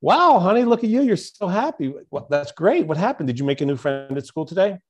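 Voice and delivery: high, bright excited mother; excited mom. Retold or imitated. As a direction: gush like a delighted mom. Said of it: "her mom sees her and goes".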